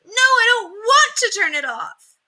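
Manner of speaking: put-on high, loud and slow child's protest; whiny refusal. A narrator's reading. Ramona refuses her mother, high and loud. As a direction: dig in like a stubborn kid.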